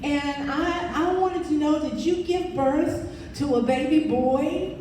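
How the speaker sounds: lightly sketch a folksy Oklahoma caller; Aunt Bee twang. Retold or imitated. The caller from Oklahoma City, with a light Southern twang. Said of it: "She sounded like Aunt Bee".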